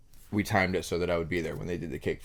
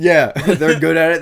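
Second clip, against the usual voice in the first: laughing; 8 semitones higher, 14 dB louder than usual. Real, not acted. Laughing while explaining kids.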